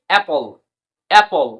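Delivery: অ্যাপল said clearly, short a then silent e; apple spelled out. Shown as a demonstration. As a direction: sound out অ্যাপল with a short first vowel, e silent. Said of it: "এ পি পি এল ই".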